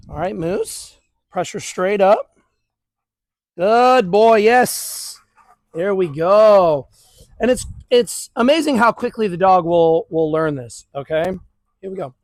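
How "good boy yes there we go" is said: louder, drawn-out praise; loud dog praise. Real, not acted. Trainer praising Moose for sitting, loud and drawn out. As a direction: cheer the dog on the moment he sits.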